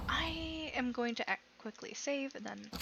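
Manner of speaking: hushed whisper to herself. Real, not acted. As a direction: breathe it out under her breath. Quietly narrating own game save.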